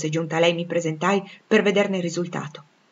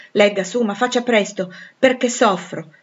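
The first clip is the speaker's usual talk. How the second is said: urgent pleading; 3 semitones higher, 6 dB louder than usual. Acted. Suitor urging her to hurry.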